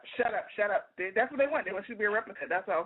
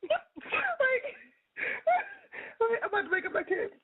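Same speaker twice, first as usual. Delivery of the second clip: higher voice through laughter; laughing; 13 semitones higher, slower than usual. Real, not acted. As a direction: talk while cracking up. Laughing hard at friend's rant.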